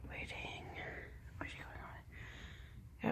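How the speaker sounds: slow unvoiced whisper. Real, not acted. Whispering while waiting. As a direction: draw it out in a hush.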